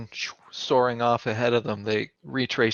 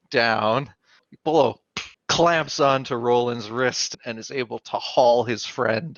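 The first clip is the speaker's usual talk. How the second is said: ordinary talk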